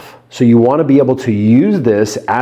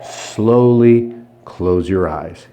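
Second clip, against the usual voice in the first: slow hypnotic command; 4 semitones lower, much slower than usual. Shown as a demonstration. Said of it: "I'm gonna say".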